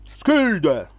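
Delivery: mock Viking shout from the movies; Viking war cry. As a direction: growl it like a movie Viking warrior. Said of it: "like when you see in the Vikings movies".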